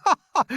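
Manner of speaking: high, loud cackling ha ha; hysterical laugh. Acted. Centipede teasing the earthworm, hysterical laughing. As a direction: burst into mischievous laughter. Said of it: "sent the centipede into hysterics".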